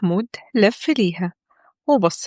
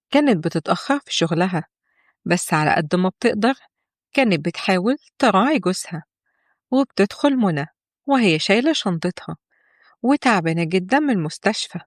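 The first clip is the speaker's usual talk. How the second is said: usual speaking voice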